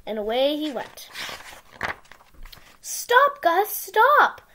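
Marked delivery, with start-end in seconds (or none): 2.8-4.4 high loud call. Acted. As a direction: yell after him to stop. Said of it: "called Sam".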